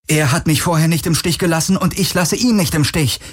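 put-on a frightened girl holding a gun, defiant; tense young woman. A narrator's reading. Narrator voices Kirai higher.

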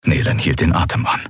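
usual speaking voice

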